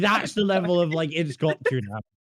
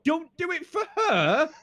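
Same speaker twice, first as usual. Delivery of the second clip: much higher, squeaky begging; high pleading; 12 semitones higher than usual. Real, not acted. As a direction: beg her not to, in a high squeal. Pleading with friend.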